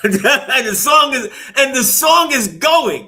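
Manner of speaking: giggling as the story gets good; laughing. Real, not acted. Friend laughing while retelling a movie scene.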